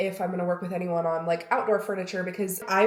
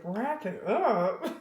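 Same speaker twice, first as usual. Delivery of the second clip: mock assistant; 3 semitones higher, much slower than usual. Voices her editor assistant.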